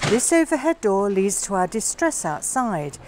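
ordinary talk, nothing marked

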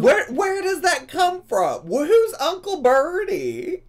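high, loud, incredulous questions; amused, astonished demand to know; baffled amazement. Real, not acted. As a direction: pitch it way up in bewilderment. Baffled by unfamiliar slang.

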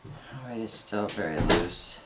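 normal talking voice

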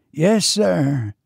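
high-pitched, deferential servant; lightly voiced loyal retainer; old servant. A narrator's reading. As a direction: answer high and obediently. Servant answers his master.

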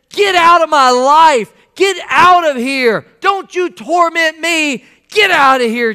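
slow, high, loud yell; hollering. Acted. Possessed man ordering Jesus away, hollering. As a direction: holler each demand at the top. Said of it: "he yells out and basically says".

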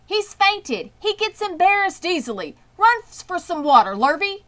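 loud, high hollering protest; frantic farmer hollering; hollered. A narrator's reading. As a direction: yell it across the ring. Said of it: "hollered Zuckerman".

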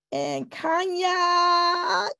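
slow, stretched calling of the name; slow sing-song call; drawn-out call. Real, not acted. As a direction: stretch the name out, summoning her. Host calling the next speaker.

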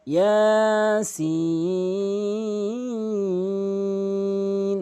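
ইয়াসিন with the সিন held very long, four alif; long drawn recitation. Shown as a demonstration. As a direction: stretch ইয়াসিন, holding সিন for four beats. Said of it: "সিন অবশ্যই চার আলিফ টানবেন".

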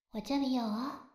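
ordinary delivery

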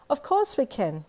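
put-on eye-rolling child. Little girl answers the boy, exasperated eye-roll voice. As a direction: toss it off like it's obvious. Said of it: "you replied, rolling your eyes".

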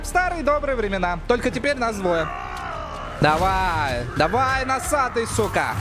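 yelled battle taunt; shouting. Real, not acted. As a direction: yell at the enemy mid-fight. Fighting the boss, shouting.

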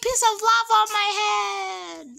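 loud shout, pitched high; yelling across the room. Real, not acted. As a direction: yell it out in alarm. Lava falling, shouting.

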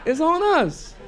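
loud, high, sing-song mock hospitality; hammed-up chirpy attendant offering a freebie; mock cheerful server. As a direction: conductor cheerfully pushing harassment on a rider.

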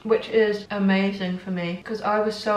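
chewing while talking; speaking between bites; mouth full of crisps. Real, not acted. Seen 0: Crisp goes into mouth at 1.3-1.7s, jaw keeps working afterwards.

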